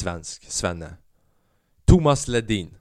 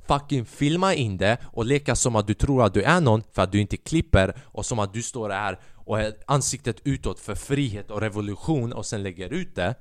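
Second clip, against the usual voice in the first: ordinary delivery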